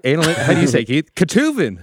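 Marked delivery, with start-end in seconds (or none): 1.1-1.8 high, louder small-town American twang; mock a small-town American pronunciation of Ketuvin; small-town American accent. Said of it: "Small town America".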